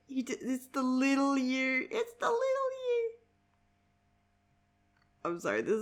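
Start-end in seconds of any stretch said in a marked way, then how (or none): 0.1-1.9 lightly put-on character voice; cutesy character quote. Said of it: "I love this where he's like". 1.9-3.1 very high, squeaky put-on voice; tiny high-pitched character voice; cutesy character quote. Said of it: "I love this where he's like".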